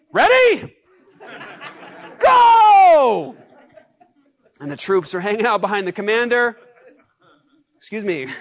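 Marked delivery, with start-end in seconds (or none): 0.1-3.3 very high, loud charge cry; battle shout. Retold or imitated. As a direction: send them charging with a scream. Commander sends troops into battle.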